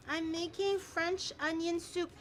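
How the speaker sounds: ordinary delivery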